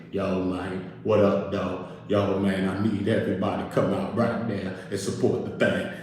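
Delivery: low, soft imitation of a couch appeal; Big Meech. Meech on the couch asking for support, low impression. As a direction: mimic him deep and low, rallying people. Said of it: "come sit on the couch like this".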